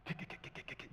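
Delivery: soft whispered kick kick; whispered kicking sounds. Pretend. Preacher miming Jesus riding to town, soft whispered spur kicks. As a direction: tap out the spurs in a whisper. Imitating spurring a horse.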